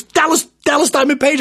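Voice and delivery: imitated fan squeal, high and loud; mimicking an overexcited fan; starstruck cop. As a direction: gush the name in a high pitch. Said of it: "and he's shaking. He goes".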